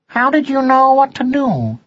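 high, curious son's voice; high, questioning lilt; young cat son. A narrator's reading. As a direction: ask dad in a high-pitched voice. Son cat questions his father.

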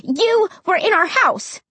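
high accusing cry. A narrator's reading. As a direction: accuse him outright. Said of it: "I stammered accusingly".

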